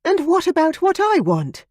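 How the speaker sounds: high, loud indignant demand. Acted. Veronica demanding of Ian, high and loud. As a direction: she demands her due, hands on hips. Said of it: "she demanded".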